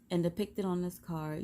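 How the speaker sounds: usual speaking voice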